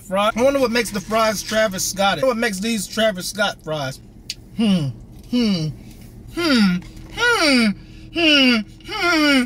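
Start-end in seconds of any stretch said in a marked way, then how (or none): 4.6-9.4 appreciative mm while tasting; savoring hums. Real, not acted. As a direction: hum with pleasure over food. Savoring the fries.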